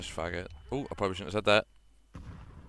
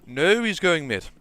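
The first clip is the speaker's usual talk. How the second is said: urgent callout; 9 semitones higher, 10 dB louder than usual. Real, not acted. Urgent callout during a round.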